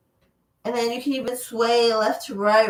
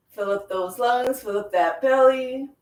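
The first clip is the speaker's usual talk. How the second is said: usual speaking voice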